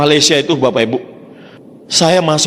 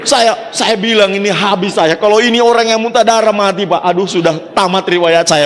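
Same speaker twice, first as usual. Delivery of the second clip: panicked; 5 semitones higher than usual. Retold or imitated. Retells his panic, shout heard.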